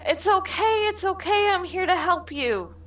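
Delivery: high, bright coaxing reassurance; eager reassuring. Acted. Frida approaching the child.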